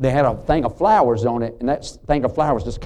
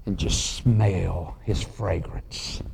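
tender memory; 4 semitones lower, 3 dB quieter, much slower than usual. Retold or imitated. Widow's words retold, tender.

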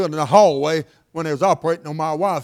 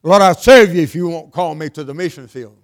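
louder pleading prayer, pressing each word; loud mock prayer; 7 dB louder than usual. Preacher acting a churchgoer bargaining with God, loud plea. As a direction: call it out like a bargain with heaven.

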